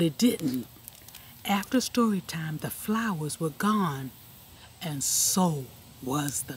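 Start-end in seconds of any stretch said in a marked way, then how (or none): none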